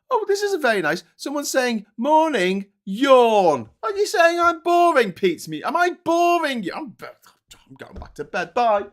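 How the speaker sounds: high, loud mock hurt, teasing the chat; mock offended. Teasing a viewer who typed yawn, mock offended and high.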